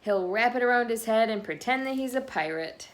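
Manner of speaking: lively picture-book read-aloud voice; reading to children. A narrator's reading. Reading a picture book to listening children, bouncy and lively. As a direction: read the story to the little ones.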